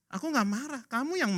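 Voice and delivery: high-pitched insistent denial; protesting. Acted. God telling parent who is the angry one, protesting. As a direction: protest and hand back the blame.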